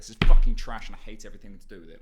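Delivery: loud, fast, bitter outburst; bitter rant. Retold or imitated. Podcaster imagines losing the role, loud fast rant. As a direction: blast it out like a sore loser. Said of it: "I'd be like".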